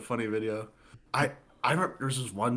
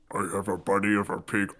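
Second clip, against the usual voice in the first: creepy demon voice; 3 semitones lower than usual. Retold or imitated. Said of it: "you hear in the background".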